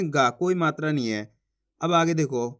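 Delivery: ordinary talk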